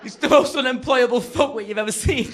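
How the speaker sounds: high, shouted comic tirade; outraged rant. Acted. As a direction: rage at full volume in disbelief. Acted outraged rant about night worker.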